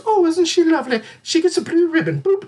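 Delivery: hammed-up cooing cat-show spectator; cooing cat fan. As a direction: coo like an admirer at a cat show. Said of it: "everybody goes".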